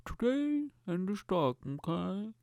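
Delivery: high, soft put-on presenter voice; cartoonish news presenter; mock newsreader. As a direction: read stocks in a silly high voice. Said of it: "learn how to do more voices".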